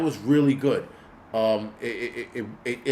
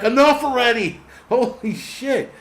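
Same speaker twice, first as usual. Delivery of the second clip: high, loud exasperated cry; fed-up outburst; 10 semitones higher, 6 dB louder than usual. Retold or imitated. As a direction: burst out, then stretch the curse slowly. Acts out being fed up.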